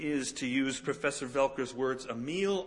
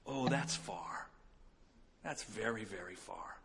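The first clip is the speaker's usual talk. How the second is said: soft whisper; 5 semitones lower, 11 dB quieter than usual. Retold or imitated. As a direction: whisper it to a sleepy child. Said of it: "whispers to the little one".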